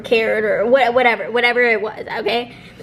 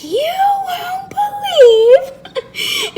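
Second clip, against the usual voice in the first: very high, drawn-out mock wail; outraged wail; 3 dB louder, much slower than usual. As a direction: wail it to mom, stretching every word. Retold outraged call to mom.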